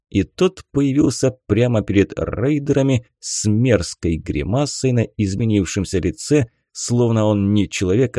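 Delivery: ordinary delivery, nothing marked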